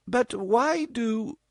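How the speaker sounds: high, slow, hesitant mother; mother's voice. A narrator's reading. Mother begins questioning her daughter, slow and hesitant. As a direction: start the question then falter.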